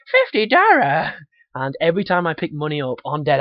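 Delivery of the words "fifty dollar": high, loud, drawn-out mock Chinese accent; cartoonish high-pitched Chinese accent; Shitty Wok guy. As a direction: shout it in a hammed-up Chinese accent. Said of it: "And the guy goes".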